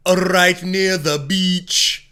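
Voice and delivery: slow, high, loud Jamaican drawl; fake Jamaican accent. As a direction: stretch it out in thick Jamaican. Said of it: "fake Jamaican accent".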